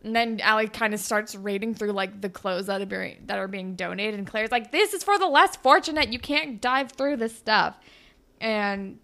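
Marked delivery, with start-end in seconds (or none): 4.7-7.8 indignant retold objection, starting high and loud; mock scolding. Claire stops Allie raiding donated clothes, mock indignation. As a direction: imitate a friend telling someone off.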